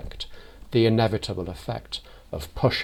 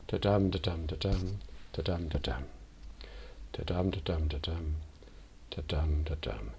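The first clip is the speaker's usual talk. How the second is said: "da-dum" beats, soft and falling; dirge rhythm; 2 semitones lower, 6 dB quieter than usual. Shown as a demonstration. Priest shows the dirge beat of the poetry, soft falling "da-dum". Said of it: "There is a dirge quality".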